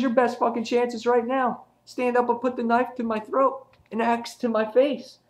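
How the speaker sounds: high, sustained intense challenge; acted villain dare. Villain daring his victim, acted out.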